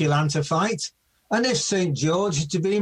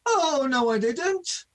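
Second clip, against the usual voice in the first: high panto denial; 9 semitones higher than usual. Acted.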